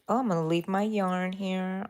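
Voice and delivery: ordinary talk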